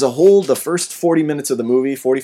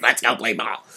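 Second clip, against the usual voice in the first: put-on softer lightly croaky kid voice; lightly voiced Froggy; 5 dB quieter than usual. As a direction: quieter take, like Froggy from Little Rascals. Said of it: "Froggy used to be like".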